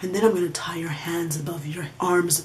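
breathy sensual whisper. Pretend. Fantasy lover whispering the next step, whispered. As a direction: hush it right into their ear.

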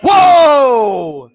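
high, loud whoa falling away. Real, not acted. Ghost hunter hears the spirit say Stony, loud amazed whoa. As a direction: let the shock spill out.

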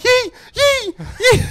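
high mock panicked wail; panicking mum. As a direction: imitate a panicking mum crying out. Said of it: "She started panicking as per usual".

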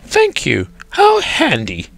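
put-on high-pitched old lady's thanks. A narrator's reading. Old lady thanks lion for tissue.

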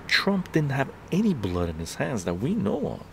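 usual speaking voice

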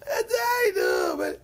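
imitating a scoffing tractor driver; dismissive outburst. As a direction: wave him off loudly. Shabtai brushes off the rabbi.

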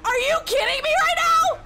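shocked outrage. Real, not acted. Realises he was drugged.

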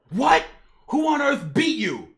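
high, loud disbelief; shocked outburst. Acted. Grandpa hears his grandson lost, loud shocked demand. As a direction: shout it in astonishment.

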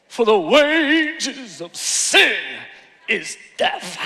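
slow, drawn-out revival preacher delivery; Pentecostal voice. Shown as a demonstration. Preacher demonstrates a verse for church, slow Pentecostal voice. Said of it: "I gotta say it in Pentecostal voice".

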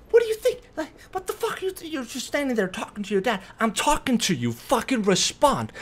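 high, incredulous retold tirade; heated acted-out telling-off, slowing on the last words; angry confrontation. Acts out his angry confrontation.

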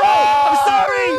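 very high, slow, loud wail; drawn-out sorry. Acted. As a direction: stretch out a dramatic apology. Letting the toothbrush fall.

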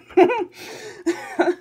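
high falling giggle; real laugh trailing off. Real, not acted. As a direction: giggle at your own joke. Laughing at own joke.

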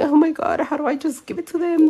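high-pitched, hurried mock fretting; playful imitation of nervous self-talk; shy panic. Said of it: "I was so shy".

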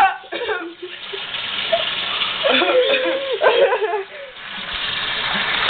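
long wheezy laugh fading out; long high laugh. Real, not acted. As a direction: laugh through the burn. After the cinnamon challenge.

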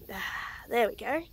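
usual speaking voice

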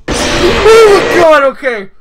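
high shriek of fright; startled shout. Real, not acted. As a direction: scream out in real shock. Jump scare in horror game.

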